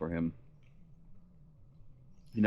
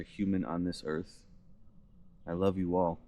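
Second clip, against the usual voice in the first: normal talking voice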